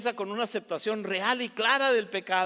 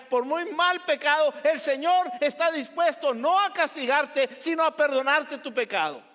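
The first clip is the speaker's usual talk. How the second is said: rising louder preaching, with a laugh at the start; preaching building; 5 semitones higher, 4 dB louder than usual. Acted. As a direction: preach it harder line by line. Predicación en crescendo. (predicación que va subiendo de fuerza)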